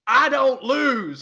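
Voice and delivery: put-on high, drawn-out Tennessee accent; hammed-up, slow Southern twang imitating a movie lawyer; bad Tennessee accent. Imitating a movie lawyer's failed Tennessee accent, hammed up. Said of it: "trying to do a Tennessee accent".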